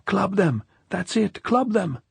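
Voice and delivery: very high-pitched excited yell; shrieked urging from a drunk; drunkard shouting. A narrator's reading. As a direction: cheer on the mob, voice right up high. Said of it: "went on shouting".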